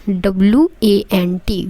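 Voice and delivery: normal talking voice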